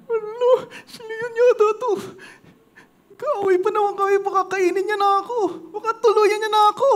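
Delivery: high, whimpering, scared voice; frightened mouse. Acted. Tiny mouse hearing the lion, whimpering high with fear. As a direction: tremble as the lion comes.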